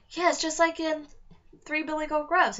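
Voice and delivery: put-on girl telling her brother about the fairy tale; happily. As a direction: sound delighted by the fairy tale. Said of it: "Alex said happily".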